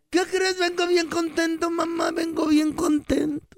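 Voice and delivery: high-pitched kid with mock Arabic accent. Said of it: "Y el niño dice".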